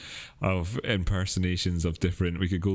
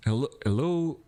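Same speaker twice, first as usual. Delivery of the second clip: put-on abandoned Crypto Kirby accent; 8 semitones higher than usual. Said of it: "no, I can't do it".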